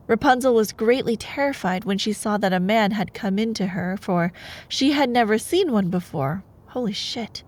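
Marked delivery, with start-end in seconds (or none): none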